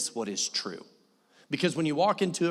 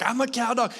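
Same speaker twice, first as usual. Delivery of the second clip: higher, eager, pleased with itself; a dog that thinks it's doing the job, voice pitched up; excited dog voice; 9 semitones higher than usual. Retold or imitated. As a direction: play the dog, bright and eager. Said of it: "she's excited and she's like".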